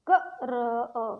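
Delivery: क, र, अ spelt out slowly; sounding out. Shown as a demonstration. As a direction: break क्र into sounds, one by one. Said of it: "अलग अलग नहीं पढ़ना है". (धीरे-धीरे ध्वनियाँ अलग करके)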